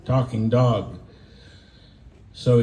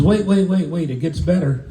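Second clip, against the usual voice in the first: pleading dog owner; 6 semitones higher than usual. Acted. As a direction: cut in eagerly to save the audition. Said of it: "And the guy says".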